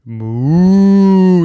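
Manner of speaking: slow loud lowing moo; long loud cow moo; drawn-out moo. Retold or imitated. Cow's moo imitated.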